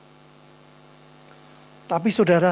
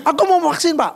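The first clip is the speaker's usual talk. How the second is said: eager teacher; 9 semitones higher, 4 dB louder, faster than usual. Acted. Acted teacher asking the pastor.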